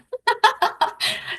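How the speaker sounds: teary laugh. Real, not acted. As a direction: let out a light, airy laugh to break the emotion. Host laughs after emotional story.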